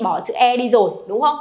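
ordinary talk, nothing marked

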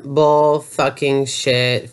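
usual speaking voice

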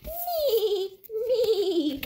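high laughing whinny; horse neigh. Acted. Horse neighs in picture book.

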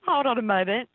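ordinary talk, nothing marked

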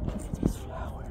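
soft hushed whisper. Real, not acted. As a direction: breathe it out softly. Hushed admiring flower.

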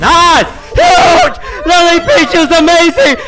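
screaming hype, very high and loud; excited shouting. Real, not acted. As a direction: scream your teammate's praise. Celebrating teammate's clutch.

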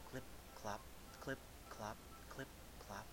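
soft, small clip clop for the youngest goat; quiet trotting of a little goat; light little hoofsteps. A narrator's reading. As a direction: tiptoe the clip clops gently. Youngest goat crossing the bridge.

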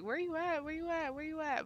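high-pitched, repeated frantic call; freaking out. Retold or imitated. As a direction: replay a panicked search in a high voice. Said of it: "I'm just freaking out".

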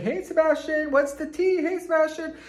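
high, loud, gushing greeting; chirpy high-pitched shout-out; friendly store staff. Retold or imitated. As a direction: sing out a hello like an adoring store worker.

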